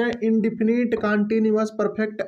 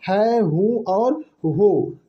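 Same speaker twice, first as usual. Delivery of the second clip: each ending spoken very slowly and apart; slow, spelled out; 4 dB louder, much slower than usual. Shown as a demonstration. Teacher listing the endings for students, very slowly. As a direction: lay out है, हूँ and हो one by one, slowly. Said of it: "इसके हिंदी के वाक्य में आता है".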